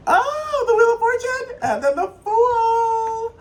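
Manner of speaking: very high, loud excited squeal; thrilled, high-pitched outburst; real excitement. Real, not acted. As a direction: squeal with delight, stretching the last word. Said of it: "you heard how excited I was feeling".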